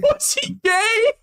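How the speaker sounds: high, slow teasing echo; mock echo. Echoes the question mockingly.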